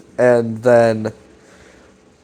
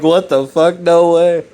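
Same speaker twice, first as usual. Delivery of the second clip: mock surprise; 5 semitones higher than usual. Retelling friends' surprised reaction.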